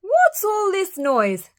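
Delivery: high, loud, slow hen voice; clucking hen. A narrator's reading. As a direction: demand it like a put-out hen. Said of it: "clucked Henny Penny".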